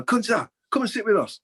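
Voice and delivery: high, loud call across the canteen. Retold or imitated. Senior pro calls the young apprentice over, loud high call. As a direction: shout him over to the top table.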